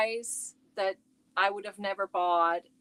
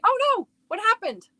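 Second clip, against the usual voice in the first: sudden high alarmed cry, then flustered; startled; 13 semitones higher than usual. Real, not acted. Streamer to viewers when the video flips, startled. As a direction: caught off guard as the camera tips over.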